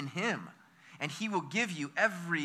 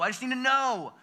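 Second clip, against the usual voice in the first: mock anxious insistence; 7 semitones higher than usual. Mocking an anxious news-watcher.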